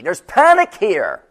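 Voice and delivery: panicked. Acted. Voicing disciples in the storm.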